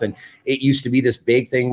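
normal talking voice

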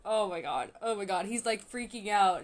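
usual speaking voice

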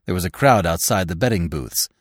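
ordinary delivery, nothing marked

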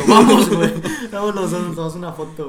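loud laughing plea, a bit fast; excited urging. Retold or imitated. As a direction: beg your friend, loud and laughing. Friend excitedly urging photo.

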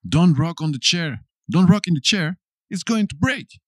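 high, urgent mock scolding; acted warning. Acts warning someone rocking a chair.

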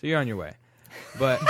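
ordinary talk

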